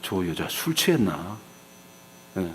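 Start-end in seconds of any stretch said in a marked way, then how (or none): 0.0-1.4 much softer and slower aside; suspicious mutter. Acted. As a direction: wonder aloud quietly, eyeing her. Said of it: "제사장 엘리가 보니까".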